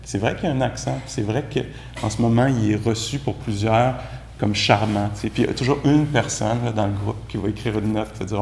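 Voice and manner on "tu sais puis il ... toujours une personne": ordinary talk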